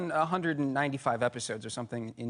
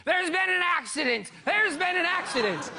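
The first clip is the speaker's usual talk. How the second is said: imitated screaming; 11 semitones higher than usual. Shirtless man in traffic, a hammed-up high yell. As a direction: shout it like a frantic man in traffic. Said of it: "he starts screaming".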